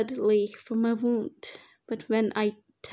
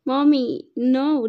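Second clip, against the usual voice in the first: put-on frightened child; 3 semitones higher, 8 dB louder, slower than usual. Child clinging to mother.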